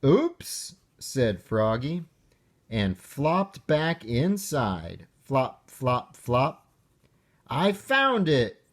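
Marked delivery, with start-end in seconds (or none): 0.0-0.8 high, rising little "Oops"; sheepish oops. Acted. Froggy realizes he forgot. 7.5-8.6 high, called-out "I found it"; cheerful yell. Acted. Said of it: "he yelled".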